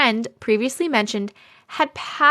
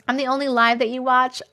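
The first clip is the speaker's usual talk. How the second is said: ordinary delivery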